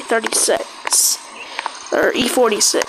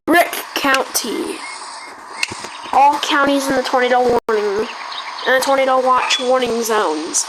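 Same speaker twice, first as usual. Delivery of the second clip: news-anchor alert read, steady and formal; mock emergency broadcast. Gamer announcing a pretend disaster to the server, announcer voice.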